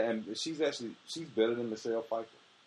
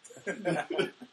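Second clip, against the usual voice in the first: high-pitched burst of laughter; 8 semitones higher than usual. Real, not acted. Co-host finds the rant hilarious, high real laugh. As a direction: crack up laughing. Co-hosts cracking up over the rant.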